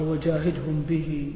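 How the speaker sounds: slow, soft recitation of the verse; quoting the verse. Shown as a demonstration. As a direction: draw the verse out slowly and softly. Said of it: "قال". (تلاوة هادئة بطيئة)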